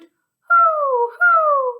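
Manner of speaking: loud high owl hoots. Pretend. Teacher answering the owl song for children, high hooting. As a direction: hoot out long like an owl. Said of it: "say hoot".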